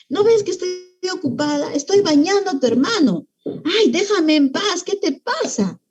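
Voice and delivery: acted irritation without real shouting; fed-up mom snapping back. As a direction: plead to be left in peace, annoyed. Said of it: "la mamá también puede sulfurarse".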